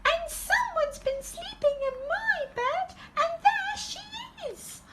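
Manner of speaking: very high little-cub squeak; Baby Bear discovering Goldilocks; wee tiny voice. A narrator's reading. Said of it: "cried in her wee tiny voice".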